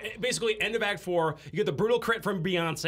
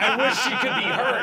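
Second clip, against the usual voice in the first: heroic wish; 6 semitones higher, 6 dB louder than usual. Acted. Alien pulls gun, makes wish.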